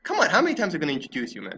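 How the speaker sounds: fast, exasperated complaint; exasperated rush. Real, not acted. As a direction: rattle it off, fed up. Exasperated at reintroductions.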